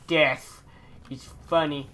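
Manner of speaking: ordinary talk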